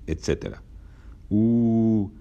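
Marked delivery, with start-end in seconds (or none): 1.3-2.1 loud teasing "Uh", higher; mocking group jeer. A narrator's reading. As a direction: jeer at the angry passengers. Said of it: "gritaron todos nuevamente".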